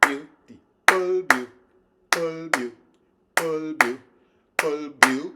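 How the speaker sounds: "beautiful" chanted loud and even; counting triplets. Shown as a demonstration. Teacher keeping the triplet count going. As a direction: keep each "beautiful" steady on the beat. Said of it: "but keep the".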